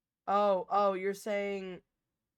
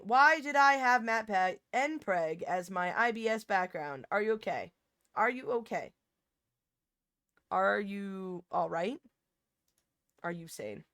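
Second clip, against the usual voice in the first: reading chat aloud; 8 dB louder than usual. Retold or imitated. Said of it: "for saying".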